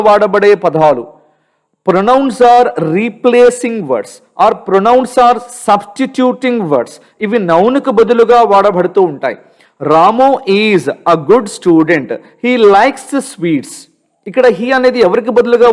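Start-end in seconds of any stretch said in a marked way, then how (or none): 1.9-6.9 slow dictation. Shown as a demonstration. Dictating a definition to students. 9.9-13.7 slow dictation. Shown as a demonstration. Reading an example sentence slowly.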